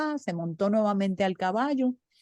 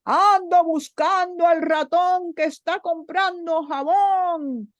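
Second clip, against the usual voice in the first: higher, louder crier's call; calling out in the street; 8 semitones higher, 3 dB louder, slower than usual. Acted. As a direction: shout it out like a town crier. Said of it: "empezó a gritar".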